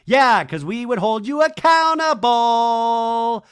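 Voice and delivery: loud, high, long drawn-out last word; sing-songy taunt. Retold or imitated. Janet retold jeering at Doty, sing-songy. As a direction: holler it in a mocking singsong. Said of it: "does a sing-songy thing".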